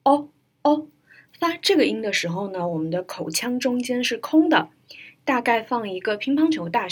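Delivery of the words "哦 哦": isolated, rounded "哦" sound, higher and louder; phonetic vowel demo. Shown as a demonstration. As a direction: model the vowel "哦" with an open, rounded mouth. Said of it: "我们来学习美式音标哦". (音标示范，圆唇发“哦”)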